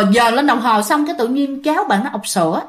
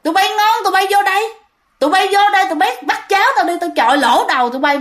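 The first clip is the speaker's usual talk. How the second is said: high, fast angry yelling back; 7 semitones higher, faster than usual. Retold or imitated. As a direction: shout back at the intruders, furious. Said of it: "bạn rống, bạn chửi lại". (hét chửi lại, giận dữ)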